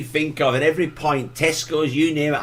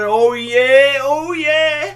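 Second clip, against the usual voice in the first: high, loud town crier cry of oh yeah; town crier call; 12 semitones higher, 3 dB louder, much slower than usual. Pretend. Cohost hails the town crier, calling out high and loud.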